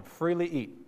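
"Freely eat" as a firm command; commanding stress on "Freely". Shown as a demonstration. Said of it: "Command form".

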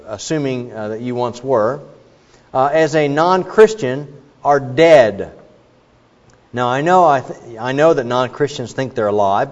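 ordinary delivery

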